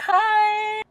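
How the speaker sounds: squeaky, very high little voice; high-pitched greeting. Retold or imitated. Greeting parents after the mall, high-pitched. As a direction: act out an awkward hello, way up high. Re-enacts awkward hello to family.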